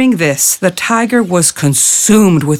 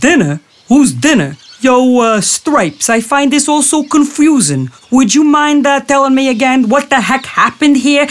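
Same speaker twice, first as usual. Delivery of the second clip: higher, nasal wise-guy jackal; dopey jackal; 7 semitones higher than usual. Acted. Jackal faking confusion to the tiger, high and dopey. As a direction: play the fool, all innocent confusion.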